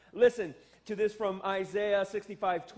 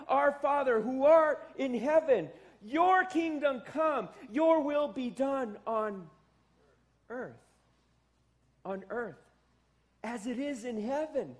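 slow, raised, louder prayer voice; prayer recitation; 5 semitones higher, 4 dB louder than usual. Acted. Pastor reciting the Lord's Prayer to the congregation, solemn and raised.

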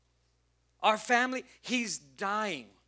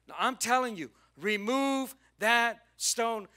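acted order, slowing right down; firm insistence; 4 semitones higher, 4 dB louder than usual. Jesus insisting to the worried sisters, slow firm command. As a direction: spell out the command slowly, word by word.

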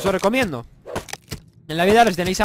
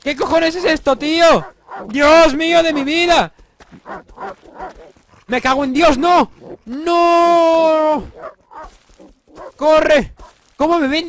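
high, loud frightened yelling; panicked screaming; 10 semitones higher, 7 dB louder, slower than usual. Real, not acted. Gamer fleeing attackers in the game, panicked high screaming. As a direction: scream in real panic as they chase you.

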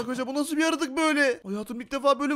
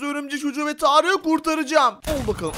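usual speaking voice

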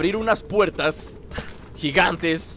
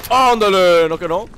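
"ándale" fuerte y celebrado; exclámalo fuerte, celebrando el disparo; grito triunfal; 7 dB louder than usual. Real, not acted. Se alegra al dar el disparo. (grito de triunfo)

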